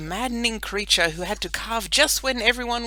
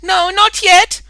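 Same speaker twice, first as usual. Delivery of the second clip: shouting; 18 dB louder, slower than usual. Acted. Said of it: "shouted Ida".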